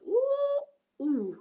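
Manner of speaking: high drawn-out "ooh", then dropping; swooning ooh. Acted. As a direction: coo in mock swoon at an accent. Swooning over the American accent.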